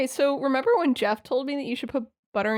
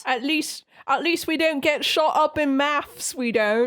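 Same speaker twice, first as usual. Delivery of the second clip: mock British accent; 6 semitones higher, slower than usual. Mocking British speakers.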